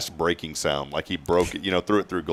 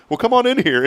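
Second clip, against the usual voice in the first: much higher, cheery invitation; inviting host; 13 semitones higher, 3 dB louder than usual. Retold or imitated. Dave inviting a guy under the soda fountain, high and cheery. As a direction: lure him in with mock cheer. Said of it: "He goes".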